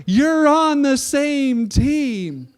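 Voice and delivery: loud, high, drawn-out scolding; exasperated refs. Retold or imitated. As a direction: plead with them as if it's obvious. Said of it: "the refs and the other guys are like".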